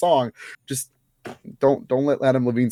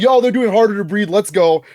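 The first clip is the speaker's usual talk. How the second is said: higher, louder fan excitement; hyped retold reaction; 6 semitones higher, 5 dB louder than usual. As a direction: act out getting pumped for the song. Retelling hyped reaction to opening song.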